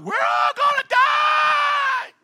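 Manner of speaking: very high, slow, loud terrified scream; screaming in terror. Retold or imitated. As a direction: fishermen panicking in the storm. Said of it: "they're screaming out".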